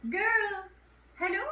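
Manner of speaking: high call across the house; father coming home and calling his daughters. Retold or imitated. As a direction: call out to the family.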